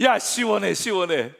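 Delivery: loud, high shout imitating dad; father calling out. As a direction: call out like dad from the hot tub. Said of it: "아버지가 뭐라 그러죠?". (목욕탕 속 아버지처럼 크게 외치기)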